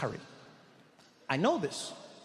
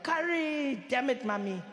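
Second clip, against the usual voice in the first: mock scream; 8 semitones higher, slower than usual. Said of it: "you're like".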